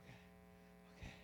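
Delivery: barely audible, recovering murmur; hushed, whispered self-reassurance; faint relief. Retold or imitated. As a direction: imitate someone catching themselves after pain. Woman recovering after prayer, retold.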